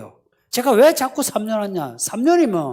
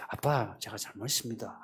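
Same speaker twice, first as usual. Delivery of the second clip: much softer, lower, contrite son; humble apology; 11 semitones lower, 15 dB quieter than usual. Retold or imitated. Son apologizing to his angry father, soft and low. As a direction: say sorry quietly, head bowed. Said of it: "가서".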